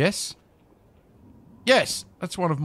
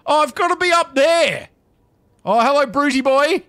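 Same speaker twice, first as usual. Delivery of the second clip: excited; 7 semitones higher, 5 dB louder than usual. Real, not acted. Excited at seeing the brute.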